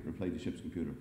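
ordinary delivery

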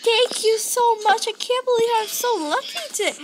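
ordinary talk, nothing marked

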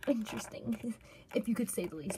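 hushed wry whisper. Real, not acted. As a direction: breathe it out as an aside. Wry aside about odd toy.

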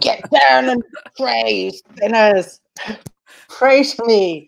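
high, loud, slow hellfire preaching; mock preacher. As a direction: ham up a doom-laden sermon to the sinners. Mock preaching as [name].

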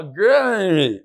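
"granary" stretched out slowly; drawn-out granary. Shown as a demonstration. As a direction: draw out the word "granary" at half pace. Drawn-out repeat after laughter at pronunciation.

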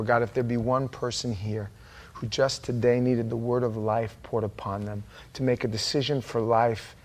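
earnest, measured prayer with long pauses; closing prayer. Real, not acted. Pastor praying for one person here to choose life, hushed earnest prayer. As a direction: speak reverently to God, unhurried.

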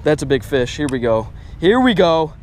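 ordinary talk, nothing marked

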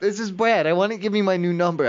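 normal talking voice

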